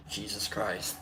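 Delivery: low, quiet weary dad sigh; a hint of a sighing dad. Real, not acted. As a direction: mutter it like a tired father. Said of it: "the dad really kicked in there".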